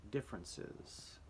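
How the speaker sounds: Differences whispered slowly and softly; soft whispered repeat. Shown as a demonstration. Teacher repeating a spelling word: slow whisper. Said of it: "here we go".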